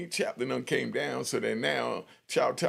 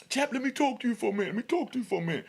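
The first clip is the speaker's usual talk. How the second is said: calling out; 11 semitones higher, much faster than usual. Retold or imitated. Inmate calling chaplain back.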